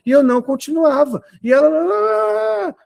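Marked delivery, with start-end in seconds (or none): 1.4-2.8 high, drawn-out mock wail; imitated yelling. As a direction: imitate a shrill rant with one long high cry. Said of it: "a Paula tava gritando". (imitação de gritaria, aguda e prolongada)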